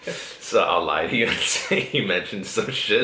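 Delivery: laughing. Real, not acted. Laughing while teasing him.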